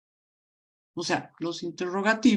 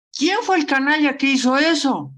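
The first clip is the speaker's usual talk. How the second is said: "¿Quién fue el canalla que hizo eso?" más alto y fuerte, con rabia; exclamando con rabia; 6 semitones higher, 7 dB louder than usual. Shown as a demonstration. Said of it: "estoy exclamando con rabia". ("¿Quién fue el canalla que hizo eso?" exclamado con rabia)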